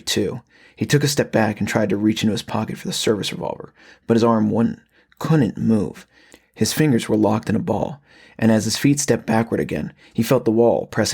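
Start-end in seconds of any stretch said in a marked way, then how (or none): none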